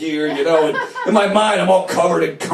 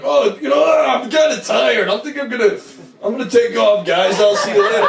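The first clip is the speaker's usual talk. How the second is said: fake casual excuse; 7 semitones higher, 3 dB louder than usual. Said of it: "rifling through the Rolodex of social ruses".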